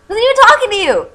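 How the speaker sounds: high, loud indignant yell. Acted. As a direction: snap it back at the old man, full volume. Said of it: "Hagrid yelled".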